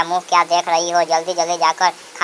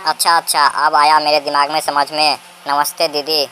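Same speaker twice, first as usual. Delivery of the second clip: ordinary talk, nothing marked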